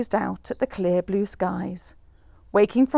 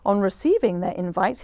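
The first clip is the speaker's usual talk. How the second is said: plain reading voice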